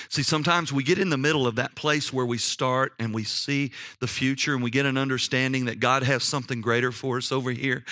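ordinary delivery

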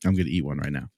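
talking while crunching a chip. Real, not acted. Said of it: "Can you hear me crunching".

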